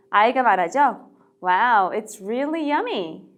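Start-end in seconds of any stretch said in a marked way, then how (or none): 1.4-3.3 slow, careful "it's really yummy"; slow model phrase. Shown as a demonstration. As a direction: spell out "it's really yummy" slowly for learners. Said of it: "정말 맛있어요". (천천히 또박또박 "it's really yummy")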